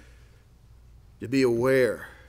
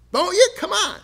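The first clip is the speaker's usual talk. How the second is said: much higher, squeaky teasing; mock taunt; 5 dB louder than usual. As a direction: push him, pitch jumping way up. Teasing Bildad, high squeal.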